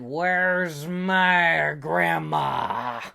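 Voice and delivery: slow, drawn-out tough-hero demand; Fred confronting the wolf. Acted. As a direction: stretch each word out in a challenge.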